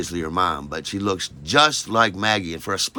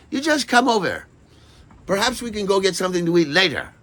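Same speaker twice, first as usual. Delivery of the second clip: Maggie's voice, imitated; 10 semitones higher, 3 dB louder, faster than usual. Maggie inviting him over.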